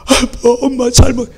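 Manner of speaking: high, frightened pleading, acted; trembling child. Child about to be beaten by parents, frightened high plea. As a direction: imitate a scared child begging pardon. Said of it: "사시나무 떨듯이 그냥 떱니다".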